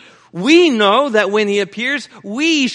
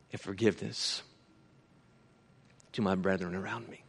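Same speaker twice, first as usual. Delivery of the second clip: hushed sermon; 10 semitones lower, 6 dB quieter than usual. Acted. Preacher speaking tenderly to the brethren, soft and low. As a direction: bring it down to a near-hush. Preacher drops to intimate reflection.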